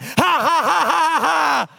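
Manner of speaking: loud high-pitched mock guffaw; retold uproarious laugh. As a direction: re-enact laughing your brains out. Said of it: "laughing my brains out".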